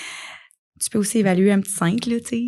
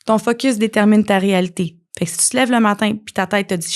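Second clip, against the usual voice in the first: usual speaking voice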